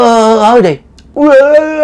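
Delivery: very high, drawn-out choking moans; strangled moaning. Retold or imitated. The neighbor hears the uncle's high choked moans. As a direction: moan as if gripped at the throat. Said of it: "ơ vậy nè".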